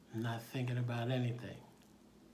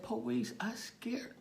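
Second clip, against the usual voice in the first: put-on high, whispery child voice; timid high-pitched boy; scared little boy; 9 semitones higher, slower than usual. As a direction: plead like a frightened small kid. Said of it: "he squeezed my hand".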